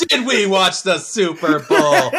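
loud, high, drawn-out mock question; laughing, incredulous repeat of a silly question; mocking echo. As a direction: stretch out every word in amused disbelief.

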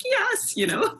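high, thrilled yes; excited yes. Retold or imitated. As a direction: squeal it with delight. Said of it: "I'm like".

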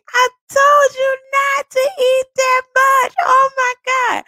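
high, loud, laughing through the words; laughing scolding. Retold or imitated. To her partner, retelling the shrimp moment, laughing and scolding. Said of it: "I'm, like, cracking up".

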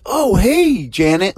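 high, bright-eyed surprised hello; excited greeting. Acted. Third woman spotting her friend Janet, excited high greeting. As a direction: call out her friend's name with delight.

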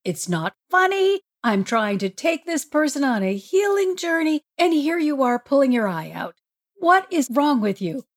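high-pitched, exasperated telling-off; mock outrage at someone who won't stop joking; indignant scolding. Acted scolding of laughing Jesus.

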